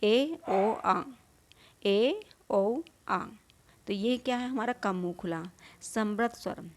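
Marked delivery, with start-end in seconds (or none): 0.0-3.2 vowels ए ओ आ stretched very slowly, mouth half open; drawn-out vowel demo. Shown as a demonstration. Teacher demonstrating half-open vowels to students, slowly drawn out. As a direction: hold each vowel ए, ओ, आ long and slow for the class. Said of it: "अर्ध विपरीत में देखिए तो कम खुलेगा".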